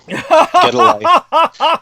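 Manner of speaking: high, slow, laughing scoff; laughing dismissal. Real, not acted. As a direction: laugh it off at the troll. Mocking a chat troll.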